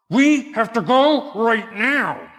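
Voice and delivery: loud, high, slow demand; upset wife. Retold or imitated. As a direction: imitate an upset wife insisting, each word drawn out. Upset wife voiced, ordering husband.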